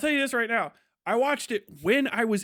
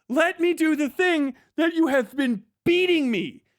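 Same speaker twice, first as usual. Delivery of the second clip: high, exasperated pleading, each demand pushed harder; spiraling robot; 6 semitones higher, 4 dB louder than usual. Acted. Robot denied its one job, high exasperated protest. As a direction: plead frantically to be allowed the job. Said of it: "you watch the robot slowly spiral out".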